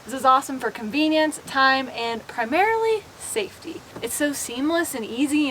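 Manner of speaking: ordinary talk, nothing marked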